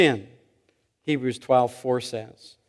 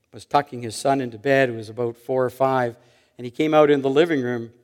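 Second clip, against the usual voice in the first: normal talking voice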